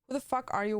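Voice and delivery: ordinary delivery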